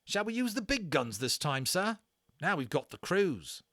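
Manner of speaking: a rattled army corporal complaining to his officer; British army corporal. Acted. Corporal asks Omri.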